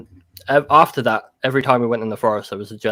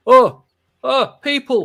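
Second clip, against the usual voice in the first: high, loud startled gasping "oh"; mock alarm, pitched way up; startled surprise; 14 semitones higher, 8 dB louder, slower than usual. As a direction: voice a creature caught off guard by people. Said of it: "there was this moment of".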